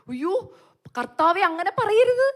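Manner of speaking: high, loud, slow pleading; anguished cry to the Lord; desperate plea. Retold or imitated. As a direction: beg the Lord not to say it.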